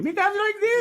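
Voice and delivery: very high, laughing squeak; high squeaky voice. Shown as a demonstration. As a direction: jump way up into a squeak. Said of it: "My voice in the evening".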